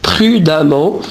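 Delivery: "prudemment" with an a sound, pru-da-ment; correct pronunciation. Shown as a demonstration. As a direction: make the middle of "prudemment" sound like "da". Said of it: "Non, on dit prudemment". (« prudemment » prononcé avec un a : pru-da-ment)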